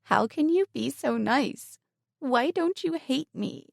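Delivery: high, shaky, slow after crying; tearful question. A narrator's reading. Lisa asking Emily why she is not hated, tearfully. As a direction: still weepy, searching. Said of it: "Lisa took a shuddering breath".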